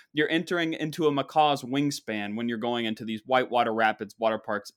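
ordinary talk

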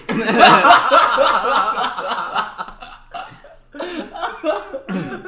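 loud, high burst of real laughter; cracking up at the dumb joke; big laugh. Real, not acted. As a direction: break up laughing at the punchline. Big laugh at the meme punchline.

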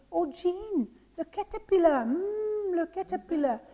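high, marvelling exclamations; enraptured wonder. Acted. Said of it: "falling in love every minute with everything".

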